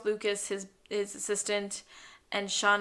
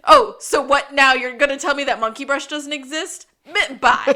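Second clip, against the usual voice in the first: higher and louder, mock indignant; loud defensive bluff; 4 semitones higher, 11 dB louder than usual. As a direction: bluster it out then bolt. Gus bluffs and runs off.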